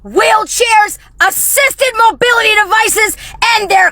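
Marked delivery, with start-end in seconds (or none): none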